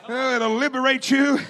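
ordinary talk, nothing marked

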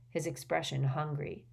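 ordinary delivery, nothing marked

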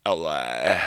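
low creaky fry on L A; vocal fry. Real, not acted. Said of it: "I really hate that I did that in a vocal fry".

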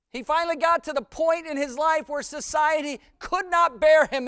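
high, loud, impassioned sermon delivery; full-force preaching. Real, not acted. Preacher telling a congregation of the criminal's life, full-force preaching.

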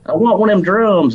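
high Alabama mom voice; mom in Alabama accent. Retold or imitated. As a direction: mimic mom asking with a Southern accent. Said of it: "She's like".

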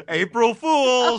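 slow, high and loud, with a laugh; spring the prank on the taxman; teasing gotcha. Pretend. Joking about filing taxes on April 1st.

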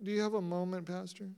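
soft, hesitant imitation of a shy request; sheepish. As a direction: trail down timidly as you ask the pastor. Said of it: "somebody will sheepishly go".

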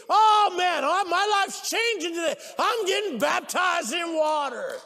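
high, loud, overjoyed cry; excited shouting. Acted. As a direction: shout it like an eager convert. Acts an eager baptism candidate.

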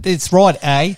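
loud Australian with "eh" tag; playful high Aussie "eh?"; Australian accent. Pretend. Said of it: "put an A at the".

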